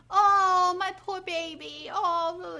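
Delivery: high, loud mock-pitying "oh, my poor baby"; hammed-up cooing over a crying baby. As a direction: camp it up like a fussing mom. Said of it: "she's like".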